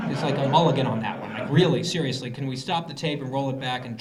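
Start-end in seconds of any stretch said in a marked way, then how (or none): none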